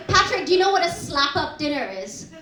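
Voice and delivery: ordinary delivery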